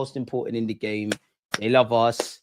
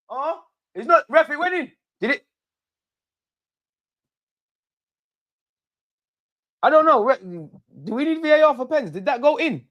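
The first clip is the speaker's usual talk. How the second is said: high surprised disbelief; 11 semitones higher than usual. Real, not acted. Unsure whether the penalty went in.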